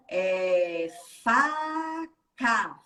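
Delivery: "faca" stretched very slow, each sound pulled apart x0.3. Shown as a demonstration. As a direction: drag "faca" out sound by sound for a child. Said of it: "quantos sonzinhos tem no faca?". ("faca" bem devagar, separando cada som)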